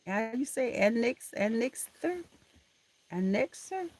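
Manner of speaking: "annexer" said high, rising, unsure; unsure pronunciation. Shown as a demonstration. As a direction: puzzle over "annexer" aloud. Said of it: "I don't know how to pronounce it".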